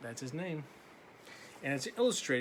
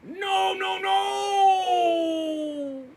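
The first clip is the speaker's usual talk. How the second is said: loud, long, high ghostly no; eerie drawn-out no; 10 dB louder, much slower than usual. Acted. The dark answering Laszlo with a long ghostly no. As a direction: moan the no like a ghost. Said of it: "said the dark".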